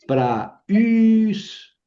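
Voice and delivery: "Pra, us" split, the us high, loud and long; two separate syllables. Shown as a demonstration. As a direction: say "Pra", then punch and stretch "us". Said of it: "pra, la primera sílaba".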